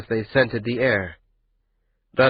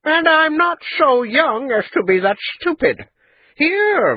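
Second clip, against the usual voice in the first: scoffing; 5 dB louder than usual. A narrator's reading. Old merchant scoffing at suggestion.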